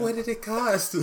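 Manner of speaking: put-on high, laughing girl's voice; little girl voice. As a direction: squeak it like a small daughter. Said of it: "His, his daughter is like".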